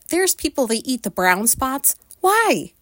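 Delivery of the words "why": high, sharply falling outburst; incredulous high-pitched cry; exasperated disbelief. Real, not acted. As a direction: demand it in baffled disgust. Baffled rant about eating brown spots.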